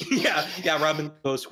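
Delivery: ordinary talk, nothing marked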